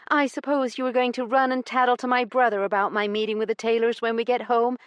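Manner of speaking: fast, high, accusing; hurled accusation. A narrator's reading. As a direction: throw the words at him. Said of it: "She threw the words at him".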